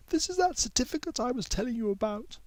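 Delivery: timid schoolgirl checking in; girl Mei Yi. A narrator's reading.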